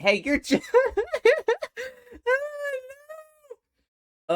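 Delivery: high, loud squeal swallowing the words; breaking into laughter. Real, not acted. Player reading the dialogue, cracking up into squeals. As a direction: lose the line to a real laughing fit.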